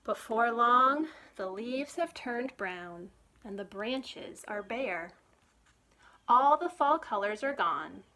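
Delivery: bright, lively storybook reading; reading to children. Acted. Librarian reading a picture book to children, lively storytime voice. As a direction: read it like storytime for little ones.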